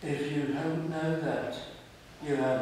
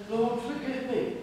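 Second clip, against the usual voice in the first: flippant prayer; 9 semitones higher than usual. Retold or imitated. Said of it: "You just trip into the presence of God".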